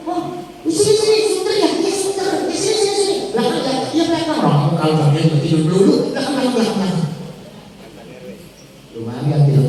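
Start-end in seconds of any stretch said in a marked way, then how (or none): 0.1-4.4 high-pitched, eager little voice; excited mock chatter. People eagerly pointing out their spot here, high-pitched mock chatter. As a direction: chirp it high, repeating eagerly. Acts out people picking a place.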